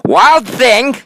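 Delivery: mother shouting his name high and loud; sharp, loud scolding call. A narrator's reading. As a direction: yell it up the stairs at a naughty boy. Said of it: "his mother called him".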